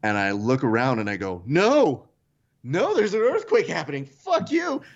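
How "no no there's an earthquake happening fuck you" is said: mock outraged defiance. Boy shouting at earthquake over his new TV, mock-indignant. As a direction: rail at the earthquake like a furious ten-year-old. Kid defies earthquake to save TV.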